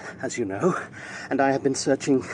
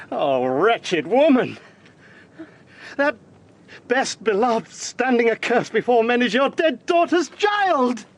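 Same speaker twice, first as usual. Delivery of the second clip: slow, raised, aghast reproach; appalled accusation; 11 semitones higher, slower than usual. Acted. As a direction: hurl the truth at her slowly. Accuses witch of cursing grandchild.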